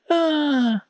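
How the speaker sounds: high delighted squeal; joyful scream. Acted. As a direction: shriek with sheer joy. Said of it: "Моюде радостно закричала".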